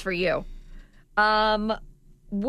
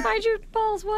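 high voice; 12 semitones higher than usual. Pretend. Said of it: "you guys all talking in high voice".

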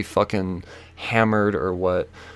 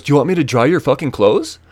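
mocking, higher and louder question; retold smart-ass offer; 5 semitones higher, 6 dB louder than usual. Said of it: "He's a smart ass".

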